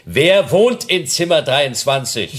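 slow, high-pitched shout for deaf ears. Acted. Detective questioning a hard-of-hearing porter, shouting slowly. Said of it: "Sie brauchen nicht zu schreien".

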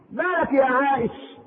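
loving, lilting concern, drawn out; tender pampering. Retold or imitated. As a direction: gently coax her out of her tears. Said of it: "بيدلعها".

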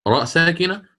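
normal talking voice

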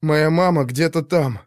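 ordinary delivery, nothing marked

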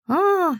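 a high startled squeak. A narrator's reading. Guard fainting at the heroine's entrance, high squeak. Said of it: "пискнул белобрысый бабкин охранничек".

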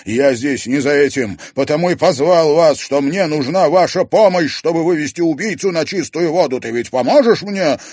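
high, loud excited plea; trembling excitement. Acted. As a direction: plead in a frenzy. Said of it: "Его трясло от возбуждения".

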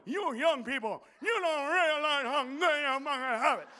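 lightly voiced cranky old man lecturing; a hint of a grumbling old-timer; grumpy old man. Old comic grumbling at young people, mild crank voice. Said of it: "be that old motherfucker going".

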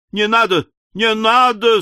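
high, pleading protest; urgent cry. A narrator's reading. Pickwick stopping them ringing the bell, crying out. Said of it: "вскричал мистер Пиквик".

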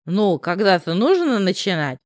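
plain reading voice

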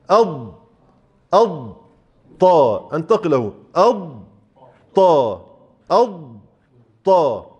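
أض held with tongue fixed, then طا; drilling the correct transition; firm drill. Shown as a demonstration. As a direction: press أض firmly, then move to طا. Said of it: "هثبت لساني جدًا".

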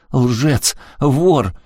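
high, loud single-word jabs; furious name-calling; angry accusation. A narrator's reading. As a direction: fling each insult out. Daughter hurls insults at father.

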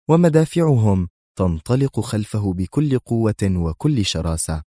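plain reading voice

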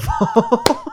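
high-pitched burst of laughter; real laugh. Real, not acted. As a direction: crack up mid-story. Laughs at his retold hang-up.

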